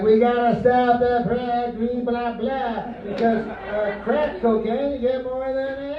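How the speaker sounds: high, droning blah-blah imitation; mock reform talkers. Comic mimicking people talking about crack sentencing, high mocking drone. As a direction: mimic their talking points as high-pitched gibberish. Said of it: "They're like".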